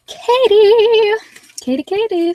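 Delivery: high, loud sing-song call of the name. Real, not acted. Host calling out to viewer Katie, high and loud.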